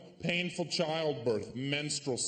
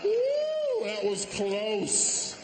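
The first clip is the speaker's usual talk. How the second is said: relieved woo; 8 semitones higher, 4 dB louder, much slower than usual. Real, not acted. Relieved exclamation.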